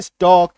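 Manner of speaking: स्टॉक with no L before the K; silent L. Shown as a demonstration. Teacher reading stalk after cutting the L, silent L. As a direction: say स्टॉक, open vowel straight into K. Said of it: "अब पढ़ो इसको".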